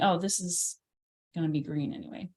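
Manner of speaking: absent-minded muttering while working; mumbling to oneself. Real, not acted. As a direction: let it sink into a private mumble. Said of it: "I'm mumbling to myself".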